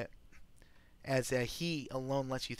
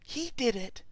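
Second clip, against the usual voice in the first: high, slow, telling on him; tattling wife; 10 semitones higher, slower than usual. Acted. Wife telling her husband the guest did it, high tattling voice. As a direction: point the finger like a tattletale. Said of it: "tells him".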